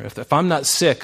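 ordinary delivery

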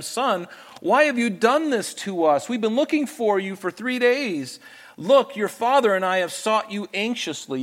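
high-pitched, worried mother's plea to her son; reproachful mother. Acted. Voices Jesus' mother confronting her son.

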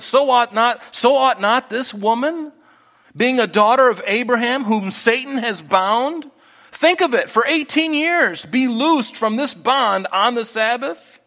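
preacher at full force rebuking hypocrites; passionate rebuke. Acted. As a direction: build the rebuke up to a cry. Jesus rebukes synagogue ruler.

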